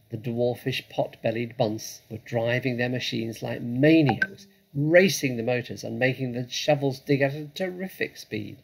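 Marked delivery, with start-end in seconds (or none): none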